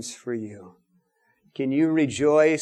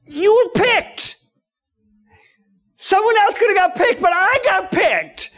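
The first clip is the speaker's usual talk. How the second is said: thrilled to be the one picked; elated; 14 semitones higher, 4 dB louder than usual. Acted. Preacher acting out being chosen to suffer, elated.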